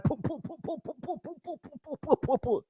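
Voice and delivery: high, soft sung poo poo poo; playful high vocal riff; high poo poo sounds. Pretend.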